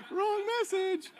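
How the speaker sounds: slow, high, laughing correction. Real, not acted. Laughs at own joke.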